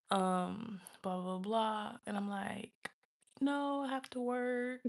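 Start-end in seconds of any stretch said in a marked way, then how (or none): 3.4-4.8 high, drawn-out cover story; fibbing excuse. Retold or imitated. Speaker hiding jail from the man, high fibbing voice. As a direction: stretch it higher, about to crack up. Retold excuse, breaking into laughter.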